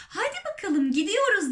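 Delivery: high, rallying call; excited shout. A narrator's reading. Old grasshopper rallying the others, excited shout. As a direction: call everyone along, pitched high. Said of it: "diye bağırdı yaşlı yeşil çekirge".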